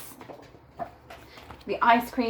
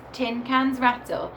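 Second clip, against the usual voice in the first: ordinary delivery, nothing marked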